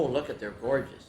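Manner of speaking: ordinary delivery, nothing marked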